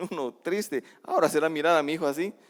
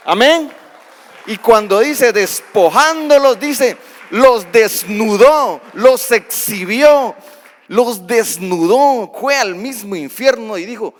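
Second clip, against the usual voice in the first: full-force preaching; 5 semitones higher, 9 dB louder than usual. Acted. Preaching climax to congregation.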